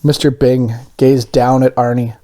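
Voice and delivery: usual speaking voice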